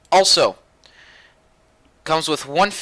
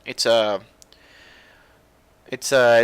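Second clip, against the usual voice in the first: ordinary talk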